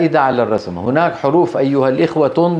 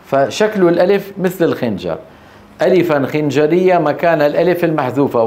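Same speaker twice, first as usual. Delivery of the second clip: normal talking voice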